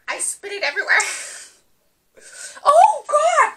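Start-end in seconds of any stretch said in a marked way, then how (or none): 2.6-3.5 high, loud wail; distressed cry. Real, not acted. Challenger reacting to sour lime, distressed wail. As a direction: cry out at the sourness.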